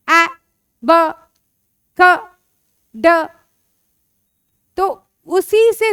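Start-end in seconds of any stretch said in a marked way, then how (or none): 0.1-3.1 "ए, ब, क, ड" as short separate sounds; phonics letter sounds. Shown as a demonstration. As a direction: sound out "ए, ब, क, ड" with pauses between. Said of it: "sound से learn कर लो". ("ए, ब, क, ड" रुक-रुककर ध्वनियों में)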